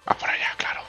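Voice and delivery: breathy whisper to himself. Real, not acted. Gamer whispering to himself as he finds the way. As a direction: whisper it as you spot the path. Figuring out the route alone.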